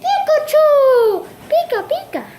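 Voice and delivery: very high, squeaky creature cry; Pikachu. Acted. Turning into the true form, high cartoon creature voice. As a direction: call out the name in a high, stretched squeal. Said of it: "my true form. Pikachu!".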